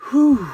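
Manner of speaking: high, loud sigh of relief; relieved whew. Acted. Mickey escaping up the chimney, relieved whew. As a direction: blow out relief after a close call.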